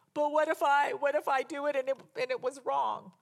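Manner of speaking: high, fretful imagined doubter; mock worried objection. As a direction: play an anxious doubter, pitched well up. Voices a worried listener's objection.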